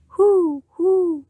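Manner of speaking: high, loud hooting owl call. Acted. The fat old owl hooting at the rabbit, high and loud. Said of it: "said a fat old owl".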